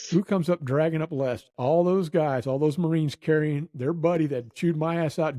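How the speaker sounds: ordinary talk, nothing marked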